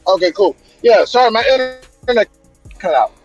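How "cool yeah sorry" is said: ordinary talk, nothing marked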